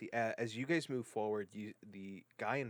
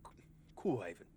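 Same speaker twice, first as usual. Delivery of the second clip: put-on rural accented guide, lifted and louder; chatty trail guide; 4 semitones higher, 6 dB louder than usual. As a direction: play an easygoing armed guide making small talk.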